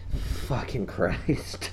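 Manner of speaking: slow breathless whisper; deflated whisper; whispered curse. Retold or imitated. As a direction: mutter it under the breath. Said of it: "I'm like".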